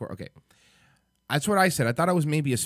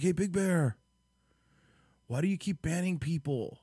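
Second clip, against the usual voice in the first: higher, pestering viewer voice; mock viewer question; 6 semitones higher, 3 dB quieter than usual. Streamer mocking viewers who ask about bans, pestering. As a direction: parrot the viewers nagging him. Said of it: "People are like".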